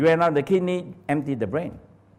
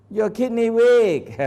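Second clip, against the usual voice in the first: a mock doctor announcing the diagnosis, higher and louder; doctor's verdict; 9 semitones higher, 6 dB louder, slower than usual. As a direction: play the grave Chinese doctor. Doctor voiced, taking pulse.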